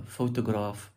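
"photograph" with PHO stressed; "photograph", slowly, weight at the front; stress on first syllable. Shown as a demonstration. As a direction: lean on the opening syllable of "photograph". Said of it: "Emphasis shifts".

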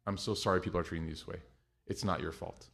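low, soft and a bit quick; soft reassuring. Retold or imitated. Mom comforting the agent, soft reassuring. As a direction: comfort them quietly. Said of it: "she wouldn't even start with the flight".

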